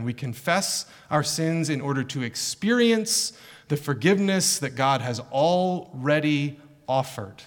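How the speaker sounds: ordinary talk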